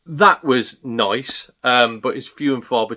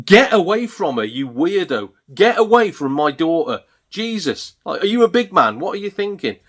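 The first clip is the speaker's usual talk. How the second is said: acted-out high, raised scolding; protective outrage; 6 semitones higher, 3 dB louder than usual. Dad to man intimidating his young daughter, outraged. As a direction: retell an appalled telling-off.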